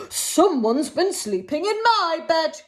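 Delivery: a hint of a gruff grunt; lightly voiced grumpy Father Bear; mild grunt of Father Bear. Storyteller voicing Father Bear, a mild grunt. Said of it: "grunted Father Bear".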